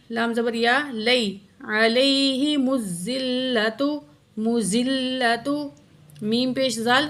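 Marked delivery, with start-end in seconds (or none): 0.9-5.7 Quran recitation. Shown as a demonstration. Teacher recites the verse.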